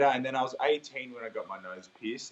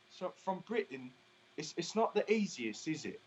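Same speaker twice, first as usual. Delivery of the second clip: higher-pitched put-on British accent; 7 semitones higher, 4 dB louder than usual. Shown as a demonstration. Singer trying a British accent on request, higher-pitched British accent. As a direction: lift the voice into a tentative British accent. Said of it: "All right, your British accent".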